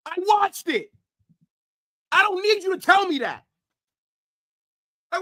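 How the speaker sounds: usual speaking voice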